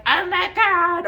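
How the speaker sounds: high, loud, drawn-out "Oh my God"; mock fangirl squeal. Teen at a concert squealing, in a mock high, loud voice. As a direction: ham up a teenage fan screaming after a concert.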